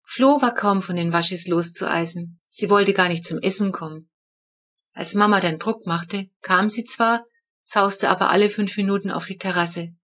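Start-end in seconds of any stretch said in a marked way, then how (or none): none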